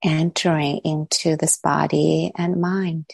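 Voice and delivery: calm, unhurried meditation voice with long pauses; guided meditation. Real, not acted. Teacher settling the group into body and breath, calm guided meditation. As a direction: guide them gently inward, leaving long silences between phrases.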